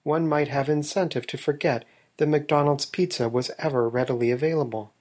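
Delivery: dry, earnest investigative narrator voice; deadpan documentary narration. Acted. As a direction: narrate it like a serious exposé, bone-dry.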